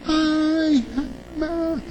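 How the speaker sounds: high, sugary put-on coo; overly sweet, fake and cloying; a high mock syrupy sigh of a voice. Shown as a demonstration. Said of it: "Não uma voz assim melada".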